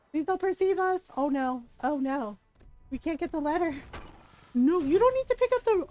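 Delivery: high-pitched alarmed pleading; playful panic. Real, not acted. Streamer sneaking past giants to get the letter, panicky. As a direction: plead with the enemies, climbing to a loud squeal. Panicking as game enemies notice her.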